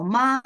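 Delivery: "ma" held high and level; first tone. Shown as a demonstration. Teacher demonstrating Mandarin tones to workshop participants, high level first tone. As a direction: sustain "ma" on one high, even pitch. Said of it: "show how the tone are moved".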